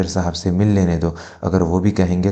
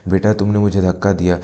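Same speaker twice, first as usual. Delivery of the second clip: usual speaking voice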